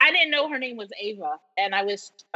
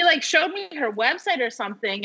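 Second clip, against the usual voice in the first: ordinary talk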